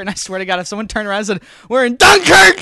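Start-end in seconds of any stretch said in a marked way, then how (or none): 1.7-2.6 loud, high imitation of a moviegoer; mock dramatic shout. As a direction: shout it like a hammy announcement. Imagined moviegoer announcing the title.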